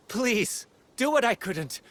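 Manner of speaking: high, earnest plea; urgent pleading. Acted. Swordswoman begging the warrior to finish what she couldn't, pleading. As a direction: beg for help, voice pitched well up. Begs other to finish her task.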